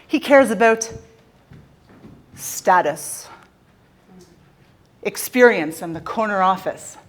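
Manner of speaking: ordinary talk, nothing marked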